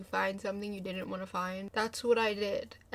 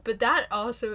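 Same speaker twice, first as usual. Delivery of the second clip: ordinary delivery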